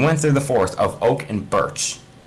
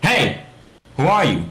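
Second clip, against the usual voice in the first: put-on high, louder startled girl; 7 semitones higher, 5 dB louder than usual. Alex startled by Steve, high and loud. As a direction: jump back and demand who he is. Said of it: "she asks him".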